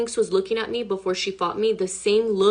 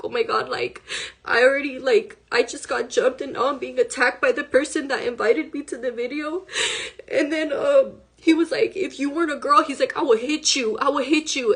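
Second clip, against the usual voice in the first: high, tearful voice, higher pitched; telling it through tears; crying while telling; 5 semitones higher than usual. Real, not acted. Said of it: "I just started crying".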